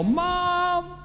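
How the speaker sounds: high-pitched call of Mom; mock yell. Son reunited with his mother on the phone, imagined yell. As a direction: cry out Mom like a long-lost son. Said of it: "I wanted to yell".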